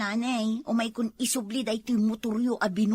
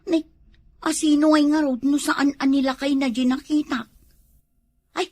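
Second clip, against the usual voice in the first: normal talking voice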